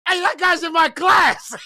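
loud, high shout through laughter; real laughing yell. Real, not acted. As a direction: cry out while cracking up.

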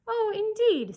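high, loud, cool "Oh, indeed"; haughty lady's put-down; disdainful oh indeed. A narrator's reading. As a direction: sniff at a governess, lightly. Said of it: "for her tone implied it".

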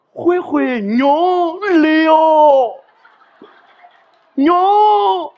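high, loud, drawn-out call of a pet name; grandma calling out. Retold or imitated. Grandmother calling her grandson home from the doorstep. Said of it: "她会叫我什么呢？".

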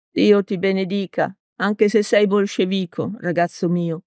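ordinary delivery

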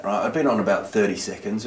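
ordinary talk

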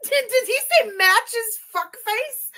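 high, loud disbelief; can't believe what he heard; slow stunned question; incredulous. Real, not acted. Incredulous reaction to clip.

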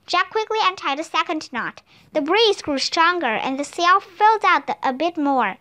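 usual speaking voice

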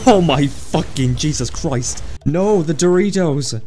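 slow, drawn-out horrified gasp of words; stunned disbelief. Real, not acted. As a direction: stretch each word in shocked awe. Explosion wrecks petrol station.

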